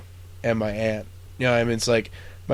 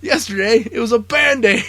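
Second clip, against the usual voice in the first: high, loud furious rant at the cook; hammed-up psycho officer yelling at the cook; angry yelling; 12 semitones higher, 6 dB louder than usual. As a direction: tear into him like a psycho officer. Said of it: "yelling at Buckman".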